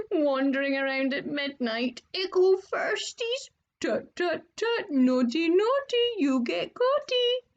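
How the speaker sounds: high, teasing sing-song taunt; a gleeful little ghost mocking the first years; cackling poltergeist. Peeves the poltergeist taunts the students, high and gleeful. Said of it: "Peeves cackled".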